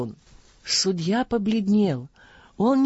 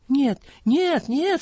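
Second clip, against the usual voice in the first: tearful wail; 8 semitones higher, slower than usual. A narrator's reading. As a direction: wail the no's, rising in panic. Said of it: "слёзно вопил судья".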